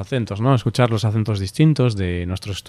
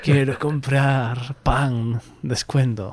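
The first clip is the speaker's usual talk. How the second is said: slow, soft zombie-like shopper chant; mock shopper; 2 semitones higher, 5 dB quieter, much slower than usual. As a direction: drone like a dazed bargain hunter. Mocking frenzied shoppers.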